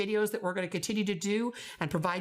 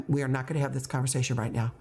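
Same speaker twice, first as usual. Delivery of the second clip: fast, level repeat of a boundary; firm, unraised voice; 4 semitones lower, faster than usual. Shown as a demonstration. Teacher talking over student's protest, firm and level. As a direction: cut in evenly, never raising the voice. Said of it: "my voice is not going up".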